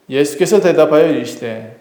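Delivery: usual speaking voice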